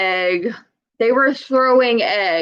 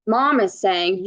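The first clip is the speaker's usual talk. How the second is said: ordinary talk, nothing marked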